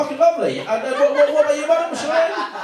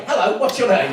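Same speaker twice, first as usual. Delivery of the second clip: ordinary talk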